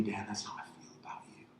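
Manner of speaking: the Lord reassuring Dan in a whisper; whispered voice of God. Retold or imitated. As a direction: whisper it like a loving confidence. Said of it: "The Lord said to me".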